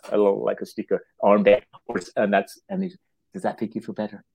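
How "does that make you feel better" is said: soft, hushed question; nurse consoling. Retold or imitated. Nurse to the professor after the armband, soothing. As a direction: ask it gently as the nurse.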